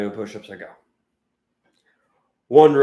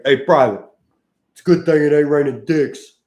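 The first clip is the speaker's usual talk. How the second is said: higher, louder barked drill sergeant line; 5 semitones higher, 8 dB louder than usual. Retold or imitated. Drill sergeant mocking a panting private, loud bark.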